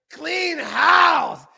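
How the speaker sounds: high, heated outburst; angry shout. Real, not acted. Fan calling for the team's bosses to go, angry shouting. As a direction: yell it as a furious demand. Outburst demanding firings, then apologises.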